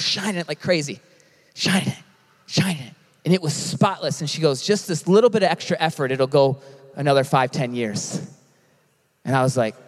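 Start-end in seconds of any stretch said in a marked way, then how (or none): 4.6-8.2 low, raspy old boss imitation; deep, rough retold advice. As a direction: drop the pitch and rasp like a little old lady. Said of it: "She had this raspy voice".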